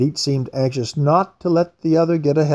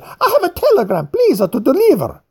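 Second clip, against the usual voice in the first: much higher, with a laugh, fussy and insistent; the fat man butts in, eager to hand over his telegram; pushy fat man; 12 semitones higher, 4 dB louder than usual. A narrator's reading. Annoyed Craxy pushes his telegram.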